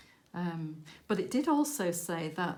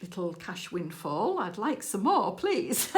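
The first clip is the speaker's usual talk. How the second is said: mock-grateful plea, breaking into a laugh; cheeky prayer. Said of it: "I was like".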